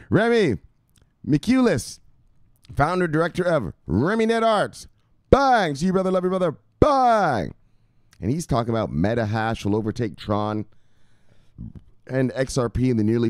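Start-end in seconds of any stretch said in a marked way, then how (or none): 0.1-7.5 high announcing hype voice; high, loud catchphrase bursts; hyped shout-out. Pretend. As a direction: call out the name and title with punch. Calling out a follower's name, title.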